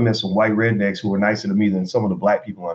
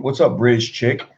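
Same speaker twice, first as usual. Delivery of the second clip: usual speaking voice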